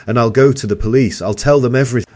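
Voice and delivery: loud, high threatening yell. A narrator's reading. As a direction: shout the threat. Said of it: "I yelled".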